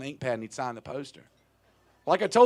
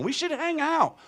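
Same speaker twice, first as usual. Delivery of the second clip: high, level pitched-up mimicry; high quoted plea; 13 semitones higher, 3 dB louder than usual. An invite from early courtship, high-pitched imitation.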